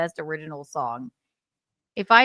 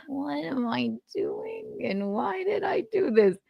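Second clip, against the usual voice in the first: mock regretful actress; 6 semitones higher, 4 dB quieter than usual. Actress questioning herself on stage, mock regret. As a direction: wail it lightly in hammed-up regret. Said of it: "And she's going".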